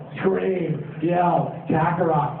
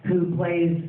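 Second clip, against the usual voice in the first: ordinary delivery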